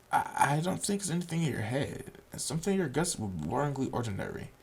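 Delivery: put-on soft deadpan girl voice; quiet Ren. Ren musing to Nakai about his condition, softly. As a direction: keep it quiet and flat-toned as Ren.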